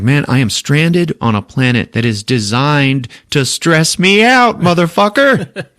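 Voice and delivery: building to a high, loud yell; hammed-up freak-out ending in a shout; mock stressed rant. Banner stressed out, rising.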